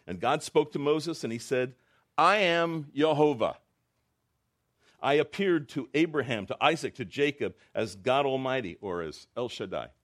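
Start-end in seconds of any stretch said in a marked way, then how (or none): 2.2-8.8 booming divine voice. Acted. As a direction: announce God's name with majesty. God's words read aloud.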